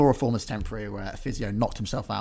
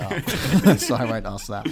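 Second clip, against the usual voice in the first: laughing; 7 dB louder, much slower than usual. Real, not acted. Laughs at own made-up story.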